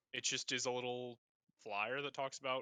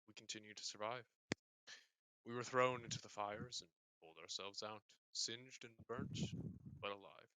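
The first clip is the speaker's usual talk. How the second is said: king's solemn speech; 3 semitones lower, 12 dB quieter than usual. Acted. King to loyal subjects on festival night, solemn address. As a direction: address the subjects gravely and quietly, unhurried. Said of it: "The king begins to speak".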